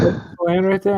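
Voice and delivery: lightly voiced God; voicing God. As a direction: play God as a cheery kid would. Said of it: "He's like".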